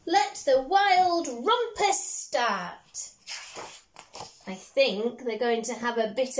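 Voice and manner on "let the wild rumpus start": slow, high, grand proclamation; cried out. Acted. Max crying out to the wild things to start the rumpus, high and grand. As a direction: announce the rumpus like a king. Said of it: "cried Max".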